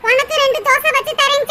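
normal talking voice

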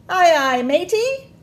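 high, loud eager pirate reply; little pirates. Acted. Little pirates answering their mommy, loud high eager reply. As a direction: shout it back like a keen deck hand. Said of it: "say her little pirates".

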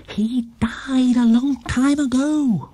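ordinary delivery, nothing marked

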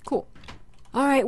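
ordinary delivery

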